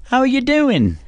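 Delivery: put-on high, prim English child voice; a high English accent, politely asking Mother how she is; child's English accent. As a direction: play a tiny English toddler greeting mum. Imitating kid with English accent.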